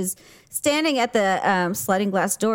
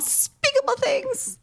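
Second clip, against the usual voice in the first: much higher put-on voice of an offended cat; cat's voice. Friend voices the indignant cat, high mock drama.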